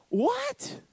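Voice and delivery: sky-high squeaky What, mock shocked; shrill incredulous yelp, hammed up; stunned disbelief. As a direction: play the flabbergasted friend, voice jumping way up. Repeats the disbelieving reaction.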